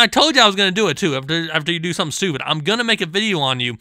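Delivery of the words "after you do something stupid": usual speaking voice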